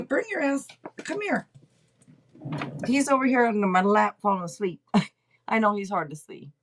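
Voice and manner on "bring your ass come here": high coaxing call to the kitten. Real, not acted. Calling the kitten over in a high coaxing voice.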